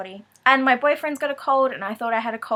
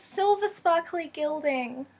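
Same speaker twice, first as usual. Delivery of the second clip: excited; 4 semitones higher, slower than usual. Real, not acted. Delighted by gilding.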